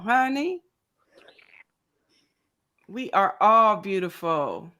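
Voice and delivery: usual speaking voice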